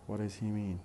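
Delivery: "What does he mean?" linked, does shrunk to a weak syllable; fast connected speech. Shown as a demonstration. Teacher pronounces examples for students, in fast connected speech. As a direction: run "What does he mean?" together, does and he unstressed. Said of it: "and then in fast speech".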